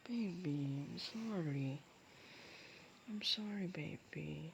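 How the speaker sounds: soft, hushed apology to a partner; tender apology. Acted. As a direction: say sorry gently, sinking toward a whisper. (malambing at mahinang paghingi ng tawad)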